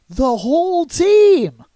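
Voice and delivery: high, loud, drawn-out cry falling at the end; incredulous exclamation. Real, not acted. Host stunned that they lost everyone, incredulous exclamation. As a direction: stretch "team" high and loud.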